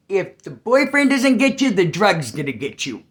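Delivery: high, loud alarmed outburst; retold alarmed shout. Retold warning to girl.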